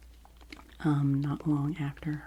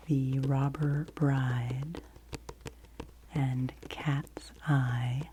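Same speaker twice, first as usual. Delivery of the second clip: savored titles; much slower than usual. Real, not acted. Savoring favorite book titles.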